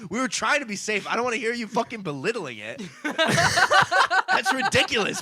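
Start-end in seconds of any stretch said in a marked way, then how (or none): 3.0-5.2 high laughing disbelief. Real, not acted. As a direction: laugh it out, stretched slow.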